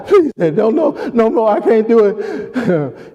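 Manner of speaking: high, helpless playful begging; pleading child. Retold or imitated. Tickled kid begging daddy, high pleading. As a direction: beg like a kid overcome by tickling. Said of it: "It's the emotion".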